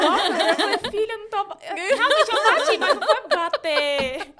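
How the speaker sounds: loud, laughing through the embarrassing retelling; embarrassed laughter. Real, not acted. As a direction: laugh at your own embarrassment. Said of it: "eu vergonha". (rindo envergonhada e alto)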